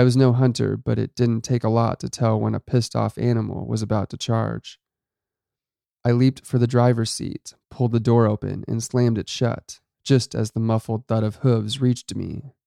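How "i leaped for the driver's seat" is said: usual speaking voice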